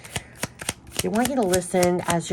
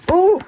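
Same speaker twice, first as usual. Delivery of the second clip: loud, sudden "ooh"; surprised ooh; 13 semitones higher, 10 dB louder than usual. Real, not acted. Tarot reader as the cards spill out, surprised. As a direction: let out a startled "ooh".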